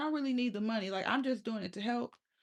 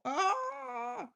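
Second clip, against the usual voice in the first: mock gasp. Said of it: "'cause y'all go".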